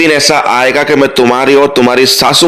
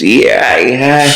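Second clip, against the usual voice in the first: playful squeal; much slower than usual. Acted. Anupama dancing in the kitchen.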